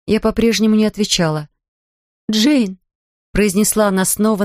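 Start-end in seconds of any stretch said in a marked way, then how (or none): 2.3-2.8 louder call. A narrator's reading. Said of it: "на этот раз уже громче".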